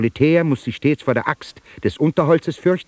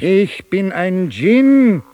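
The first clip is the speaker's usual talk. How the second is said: grand proclamation; 6 semitones higher, 5 dB louder, much slower than usual. Acted. Djinn proclaims what he is.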